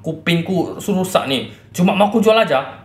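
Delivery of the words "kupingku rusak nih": ordinary delivery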